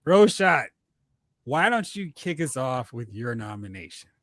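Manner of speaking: normal talking voice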